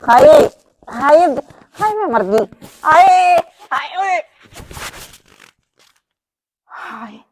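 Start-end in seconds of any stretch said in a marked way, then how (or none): 0.0-4.2 slow, loud drawn-out wails of pain; pained wailing. Acted. Sister-in-law, just woken from being choked, wails in pain. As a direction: cry out as if just half-strangled.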